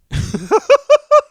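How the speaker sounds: high, loud burst of laughter; big cackling laugh. Real, not acted. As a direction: crack up at your own story. Laughs at his own story.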